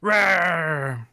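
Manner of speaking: playful little dragon rawr; dragon roar. Pretend. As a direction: growl it like a cartoon dragon. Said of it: "Do you like my dragon impression?".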